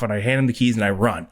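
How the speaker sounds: ordinary talk, nothing marked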